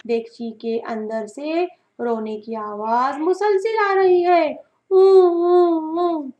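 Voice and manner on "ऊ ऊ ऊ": high, muffled wail from inside a pot; muffled boyish wailing. Acted. Boy stuck in the pot, muffled wailing. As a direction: wail like a trapped child.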